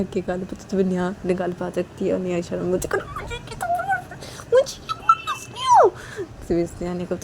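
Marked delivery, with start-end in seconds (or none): 3.2-5.9 very high, squeaky crying complaint; crying whimper. Acted. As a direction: whimper like a hurt little girl. Slapped Neha whimpers.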